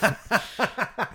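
squealing laugh, high; high burst of laughter. Real, not acted. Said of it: "that is so fucking funny".

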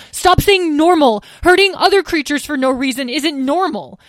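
angry. A narrator's reading. Son cuts mother off angrily.

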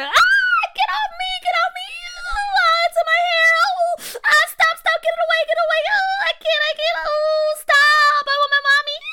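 high, loud frantic screams; panicked screaming. Acted. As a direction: shriek as bugs land in her hair. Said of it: "McKinley continued to scream".

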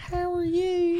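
slow, very high falsetto greeting; mock gushing girl. Mocking a fan's greeting, slow and very high. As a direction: drag it out high and sugary.

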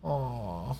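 soft, hushed aw of fake shame; dad pretending to be sorry for swearing; mock-guilty hush. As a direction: drop to a hush like a scolded kid. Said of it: "I said a bad word".

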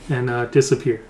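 usual speaking voice

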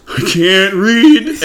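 high, loud, drawn-out wail; tearful confession. Retold or imitated. Kid confessing to the teacher, high and slow wail. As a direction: stretch it out like a crushed kid. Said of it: "And I was like".

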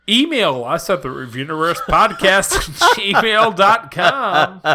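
announcer-style outro read, a bit tipsy; tipsy podcast sign-off. Acted. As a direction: read the sign-off like a radio host. Drunk host reads the outro.

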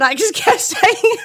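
high, slow laughing words. Real, not acted. Friend laughing at her own drunken U-Bahn mix-up, giggling.

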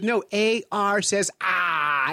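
"ah" drawn out, R dropped. Retold or imitated. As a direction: insist slowly that it says "ah". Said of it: "she couldn't say R".

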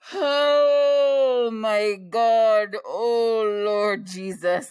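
loud, long drawn-out exasperated wail; very slow, loud, high groan of exasperation; agitated wail. Real, not acted. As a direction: cry out to the Lord in weary agitation. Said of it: "like, so agitated".

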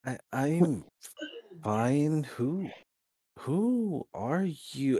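lightly voiced hesitant boy, softly. Hurt boy answers the stranger, mildly hesitant. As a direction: falter softly between words, a touch bewildered.

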